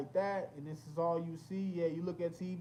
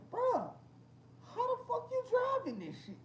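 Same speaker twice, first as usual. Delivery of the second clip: much higher incredulous squeal; mock disbelief. Recalling the narrow Japanese roads, high mock disbelief. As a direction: squeal at the skinny road in mock shock. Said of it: "I'm like".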